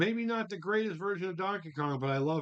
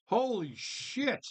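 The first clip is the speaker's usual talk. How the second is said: amazed; 6 semitones higher, much slower than usual. Real, not acted. Reacting to forty dollar price.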